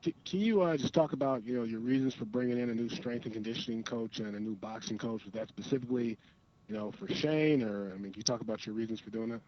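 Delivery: thin, distant phone line; on speakerphone. Real, not acted. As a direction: ask as if calling in by phone. Reporter calling in to presser.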